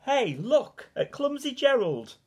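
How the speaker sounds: high, loud taunting call; mocking jeer. A narrator's reading. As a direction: jeer at him like a schoolyard bully. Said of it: "all the animals laughed".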